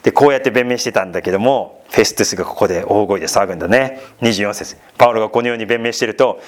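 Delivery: ordinary talk, nothing marked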